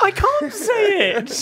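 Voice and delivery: very high, slow squeal of amusement; overcome with amusement. Real, not acted. Co-host refusing to repeat the reply, helpless squeal.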